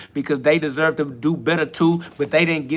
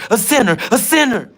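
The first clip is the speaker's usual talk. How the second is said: chanted a sinner, a sinner, higher and louder; louder taunt; 7 semitones higher, 5 dB louder than usual. Acted. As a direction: jeer it at the top of the taunt.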